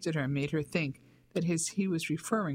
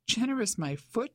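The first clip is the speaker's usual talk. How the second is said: a raised, scoffing exclamation; indignant exclamation; 6 semitones higher than usual. A narrator's reading. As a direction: exclaim it in higher-pitched mock outrage. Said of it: "she exclaimed".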